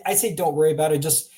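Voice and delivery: ordinary talk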